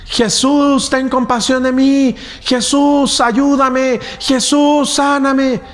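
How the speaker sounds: high pleading cry for help. Acted. The woman begs Jesus from the crowd, high pleading cry. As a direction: call out to him, begging to be healed. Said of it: "alguien empieza a gritar".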